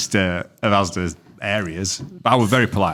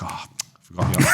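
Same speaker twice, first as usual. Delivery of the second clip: mock whisper; 3 semitones lower, 3 dB quieter, faster than usual. Retold worker's sheepish excuse.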